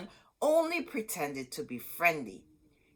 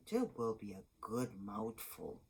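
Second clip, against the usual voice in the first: lower, softer witch voice; a hint of a hungry witch gloating; 7 semitones lower, 7 dB quieter than usual. A narrator's reading. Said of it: "she said".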